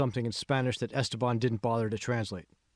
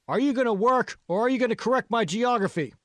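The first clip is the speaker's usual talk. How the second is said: much higher, louder sarcastic yell; angry yell; 11 semitones higher, 6 dB louder than usual. A narrator's reading. Supervisor to the girl who corrected him, angry yell. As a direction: bark the question back at her in anger. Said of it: "Ozzy yelled".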